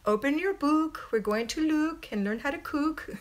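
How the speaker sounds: a hint of an Irish nun's accent. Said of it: "they would say".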